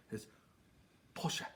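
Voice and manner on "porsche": Porsche as PORSH-uh, soft; Porsche the correct German way; German pronunciation. Shown as a demonstration. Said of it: "correct pronunciation".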